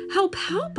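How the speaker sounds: high-pitched cry for help; urgent plea. A narrator's reading. Boy calls police for help.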